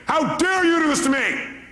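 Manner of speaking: high, slow indignant wail; mock angry cry. Speaker acting an angry person crying for help. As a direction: wail it like a hurt accusation. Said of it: "Somebody's angry".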